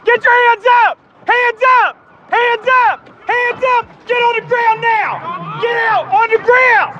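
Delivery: high, full-force command yell; shouted surrender demands. Real, not acted. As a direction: bark orders at a suspect. Felony stop at gunpoint.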